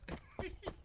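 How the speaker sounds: a high, airy, unvoiced laugh; breathy high laugh. Scrooge delighted with the boy, laughing in a breathy high giggle.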